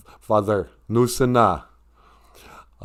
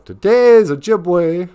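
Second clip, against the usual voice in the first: slow, loud, higher title call; show announcer; 7 semitones higher, 7 dB louder, much slower than usual. Pretend.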